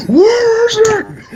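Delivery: drawn-out, high strained movie Batman; hammed-up superhero interrogation, stretched out; mock an over-the-top Batman demand.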